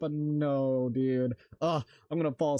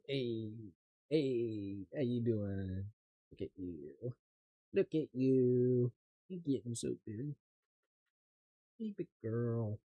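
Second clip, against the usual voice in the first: soft pet talk; 3 semitones lower, 6 dB quieter, slower than usual. Real, not acted.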